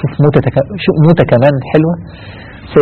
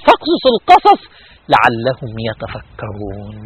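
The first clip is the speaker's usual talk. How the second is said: verse recitation; 5 semitones lower, 3 dB quieter, slower than usual. Acted. Verse recited, fading to hush.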